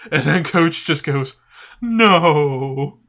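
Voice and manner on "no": long drawn-out wailed no, building louder; hammed-up sobbing wail; mock crying. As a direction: imitate a tearful, stretched-out cry. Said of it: "He starts crying like".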